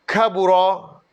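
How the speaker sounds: ordinary talk, nothing marked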